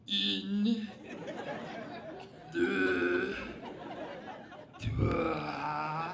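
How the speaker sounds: put-on loud, over-the-top French accent counting; a loud mock French accent, word by word; exaggerated French accent. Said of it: "in the most exaggerated accent".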